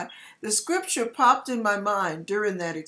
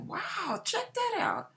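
hushed awe; 11 semitones higher, 7 dB quieter than usual. Retold or imitated. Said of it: "I'm like".

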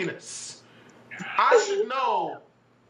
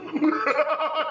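burst of laughter; 6 semitones higher than usual. Real, not acted. Laughing at a drawing.

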